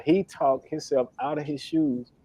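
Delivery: ordinary talk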